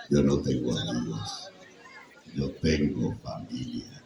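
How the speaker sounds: deep, heavy family man; low rumble, every word weighed; Toretto. Shown as a demonstration. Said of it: "Así sonaría si lo hiciera yo".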